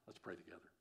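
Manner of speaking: very soft, low call to prayer; hushed invitation. Real, not acted. Preacher asks congregation to pray together, hushed. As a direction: drop almost to a murmur to lead into prayer.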